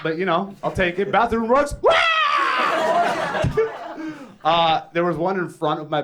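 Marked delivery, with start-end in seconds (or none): 1.8-4.4 long, very high-pitched shriek; mock scream. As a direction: let out a drawn-out comic screech. Screamed noise after bathroom rugs.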